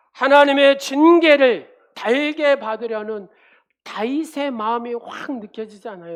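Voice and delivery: loud, fervent sermon delivery; preaching. Acted. Pastor preaching to the congregation, loud and fervent. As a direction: preach it out to the whole church.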